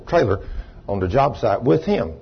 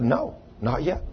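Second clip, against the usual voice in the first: the Spirit's voice; 3 semitones higher, 8 dB quieter, slower than usual. Retold or imitated. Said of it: "And the Spirit said".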